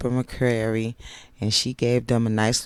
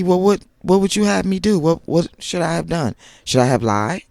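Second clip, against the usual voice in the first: higher, louder imitation of Kimmy; Kimmy's pleading quote; 7 semitones higher, 6 dB louder than usual. Vlogger retelling Kimmy questioning Kiki, louder. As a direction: replay Kimmy's protest, pitched up and louder.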